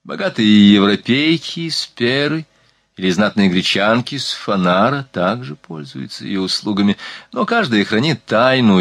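ordinary delivery

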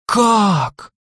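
high, thrilled "how?"; eager little girls asking; delighted. Acted. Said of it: "с восхищением спросили они". (с восхищением)

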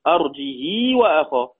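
"أرجه وأخاه" slow, with a long "hii"; lengthened kasra, no hamza. Shown as a demonstration. Teacher repeating Warsh's reading, "أرجه وأخاه" as arjihii. وكسر وصلة.

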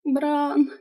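tiny high squeak through tears; kitten voice. A narrator's reading. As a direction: squeak it like a kitten, barely audible. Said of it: "я еле слышно, как котёнок, пропищала".

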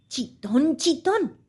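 a small, high, frightened voice; terrified pleading. A narrator's reading. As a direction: plead in a tiny scared voice. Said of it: "suplicó el conejo con una vocecilla aterrada".

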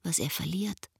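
hushed, breathy-free whisper of wonder; a secret told under the stars. Acted. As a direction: whisper it to the night sky. Inner voice over night sky.

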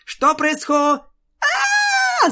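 high loud shriek breaking off; scream cut off. Acted. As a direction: scream as it cracks. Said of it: "завизжала Кудряшка". (пронзительный визг)

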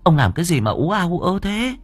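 high-voiced old wife, concerned scolding; fussing wife. A narrator's reading. Wife sees husband sweating, high fussing concern. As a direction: fuss over him in a high voice.

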